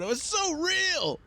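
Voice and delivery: very high, slow, gushing wonder; faraway visitor marveling at the hologram birds; amazed onlooker. Retold or imitated. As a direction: squeal it like a dazzled zoo visitor. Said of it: "one guy ... going".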